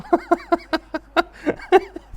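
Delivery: high, loud peals of laughter; delighted cackle. Real, not acted. Fan thrilled by Araujo's defending, high cackling. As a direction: cackle helplessly with glee.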